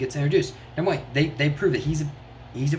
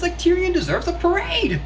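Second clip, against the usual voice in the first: gushing; 4 dB louder than usual. Real, not acted. Gushing about favourite character.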